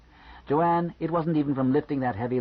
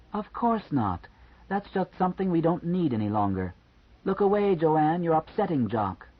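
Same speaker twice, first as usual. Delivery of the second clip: higher, lightly feminine voicing; Eunice's voice; 6 semitones higher than usual. A narrator's reading. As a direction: lift the voice for Eunice, teasing. Eunice's voice inside Joanne's head.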